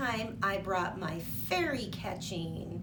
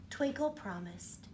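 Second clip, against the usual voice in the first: ordinary talk, nothing marked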